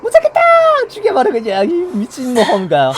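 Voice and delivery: high, loud enraged yell; furious shout. Acted. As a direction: explode in rage. Killer losing patience.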